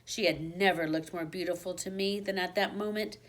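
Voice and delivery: ordinary delivery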